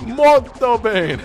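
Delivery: mock Italian. Host teasing co-hosts in a mock Italian accent. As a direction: toss it off like a cartoon Italian chef. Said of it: "tempted to do the accent".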